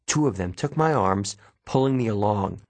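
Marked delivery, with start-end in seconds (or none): none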